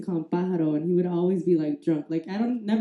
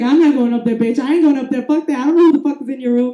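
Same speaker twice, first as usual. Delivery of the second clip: adamant refusal; 3 semitones higher, 6 dB louder, faster than usual. Retold or imitated. Scared friend refuses to go up.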